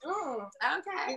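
dry sarcastic high okay; a knowing, sarcastic little okay. Retold or imitated. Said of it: "I'll say stuff sarcastically and be like".

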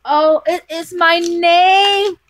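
loud, high, drawn-out squeal; thrilled surprise. Real, not acted. Finding her own name on the gift, thrilled squeal. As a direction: squeal it slowly with rising delight.

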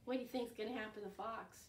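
usual speaking voice

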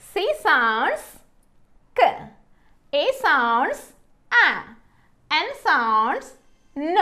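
"K", "A", "N" as isolated sounds; swooping "sounds", then the bare phonic after a pause; phonics sounding-out. Shown as a demonstration. Teacher sounding out C, A, N for learners, each sound separate. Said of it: "ఎలా పలుకుతుందో చూద్దాము".

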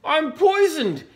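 high, loud, hammed-up wail; anguished howl. A narrator's reading. Dingo tastes the stew, howling. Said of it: "he howled".

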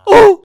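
high, loud oh of delight; exaggerated happy gasp. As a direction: squeal with surprise. Delighted at the surprise.

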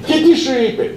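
high anxious. Retold or imitated. Boss asks.

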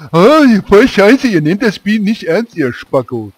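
high, loud, slow mock rant; hammed-up outrage at lazy players; angry viewer. Streamer imitating an angry viewer who complains, mock furious shout. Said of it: "Sonst heißt das irgendwann".

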